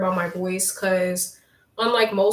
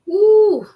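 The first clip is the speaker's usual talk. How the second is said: high loud woo cheer; bright high whoop of delight; happy cheer; 11 semitones higher, 10 dB louder than usual. Real, not acted. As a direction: whoop with joy. Cheering a chat comment.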